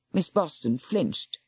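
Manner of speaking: usual speaking voice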